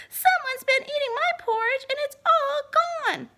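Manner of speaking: put-on Baby Bear. Baby Bear finding his porridge all gone, high and loud. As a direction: wail it like an upset little cub.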